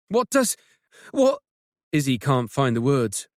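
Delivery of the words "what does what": flustered. Acted. Izzy can't find the words.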